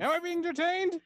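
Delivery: shrill sovereign citizen. Retold or imitated. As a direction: overdo the outraged citizen. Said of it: "sovereign citizen".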